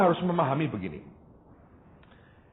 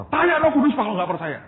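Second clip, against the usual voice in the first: son's challenge; 10 semitones higher, 5 dB louder than usual. Retold or imitated. Son's honest challenge quoted.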